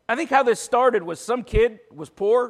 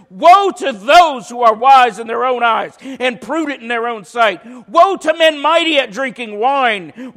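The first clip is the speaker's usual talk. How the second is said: louder, driving sermon voice; preaching; 5 semitones higher, 8 dB louder than usual. Acted. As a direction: preach it with building force. Preaching at full force.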